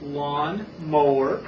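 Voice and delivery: drawn out in two held parts; slow model. Shown as a demonstration. Teacher tells Paul to wait, slow and separated. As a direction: pull "Lawn mower" apart slowly. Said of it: "wait till I tell you to say it fast".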